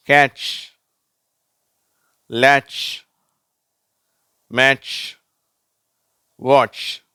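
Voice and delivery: isolated words with pauses, sh finish; teacher's emphatic TCH word list; correct TCH ending. Shown as a demonstration. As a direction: model கேட்ச், லேட்ச், மேட்ச், வாட்ச், each ending t plus sh. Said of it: "நான் வாசிச்சு காட்டுறேன்".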